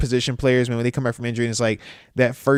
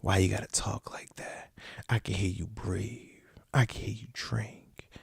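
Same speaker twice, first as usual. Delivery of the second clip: hushed unvoiced whisper, slowed down; mock creepy ASMR whisper; 8 semitones lower, 11 dB quieter than usual. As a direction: whisper it back to them, teasing. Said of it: "They said".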